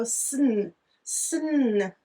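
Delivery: isolated sn sound, drawn out; kids sounding sn. Retold or imitated. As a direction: voice the children repeating sn. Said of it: "And they go".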